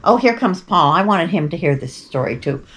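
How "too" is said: normal talking voice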